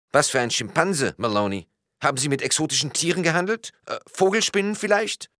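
very high, quizzical character voice; bewildered policeman. A narrator's reading. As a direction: question it as a baffled policeman, pitched high. Policeman's voice in story.

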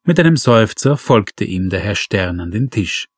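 ordinary delivery, nothing marked

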